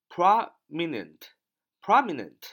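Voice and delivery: "prominent" slowly, weight at the very front; stress on first syllable. Shown as a demonstration. Teacher showing where stress falls, "prominent" stressed on its first syllable. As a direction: lean on "prom" in "prominent". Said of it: "重音在啊这个最前面啊".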